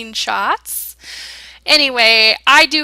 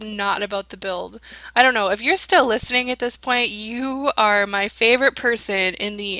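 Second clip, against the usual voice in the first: ordinary delivery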